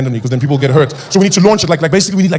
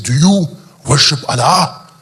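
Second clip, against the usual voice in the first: slow foreign questioner; 4 dB louder, slower than usual. Retold or imitated. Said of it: "they asked them, they said".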